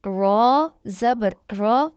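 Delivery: slow sing-song spelling of each letter; slow syllable drill. Shown as a demonstration. Tajweed teacher drilling students syllable by syllable. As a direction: spell out each syllable slowly, like a Quran class.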